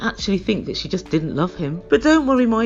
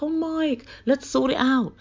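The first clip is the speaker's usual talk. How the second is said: put-on pleading, pitched up; mock coaxing; 4 semitones higher, slower than usual. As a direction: imitate a girlfriend wheedling her boyfriend. Acts out Natalie's sudden sweet turn.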